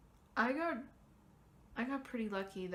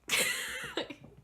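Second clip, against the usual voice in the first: high disbelieving laugh. Real, not acted. Laughs at mom's advice.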